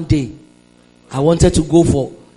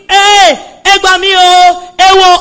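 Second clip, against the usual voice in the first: high, loud Yoruba wail for help; cry of pain; 11 semitones higher, 8 dB louder, slower than usual. Retold or imitated. She shows the scream she did not scream, high and loud. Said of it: "But I was not doing".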